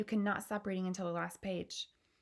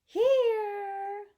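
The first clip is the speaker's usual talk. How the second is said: very high, loud, long held call; piping shout; 12 semitones higher, 8 dB louder than usual. Acted. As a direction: sing out from nowhere. Invisible boy's last, biggest answer.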